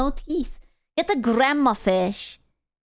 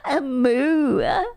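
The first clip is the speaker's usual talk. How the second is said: laughing moo; 7 semitones higher, much slower than usual. Real, not acted.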